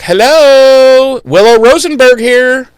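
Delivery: high, loud sing-out hello; cheery Willow. Retold or imitated. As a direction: announce yourself loudly in a high voice. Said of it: "she just walks in and she's like".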